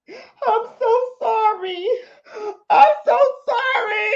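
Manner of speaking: high wailing sobbed apology; bawling. Retold or imitated. Woman on camera after arrest, sobbing apology. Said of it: "She's bawling".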